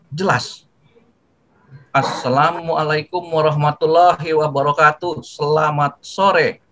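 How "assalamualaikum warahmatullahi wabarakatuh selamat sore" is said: slow, clear salam with every word distinct; clear, unhurried greeting. Shown as a demonstration. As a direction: greet them calmly and clearly, no rushing. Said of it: "Nah, ya kan jelas ya?". (ucapkan salam dengan pelan dan jelas)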